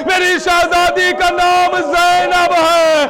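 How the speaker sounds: ordinary talk, nothing marked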